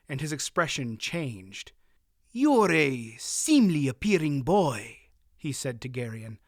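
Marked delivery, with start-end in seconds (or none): 2.3-5.1 put-on harsh accented voice. A narrator's reading. Murgo probing the boy.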